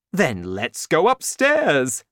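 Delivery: rally your son to move; excited cry. A narrator's reading. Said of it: "cried Mister Cranky".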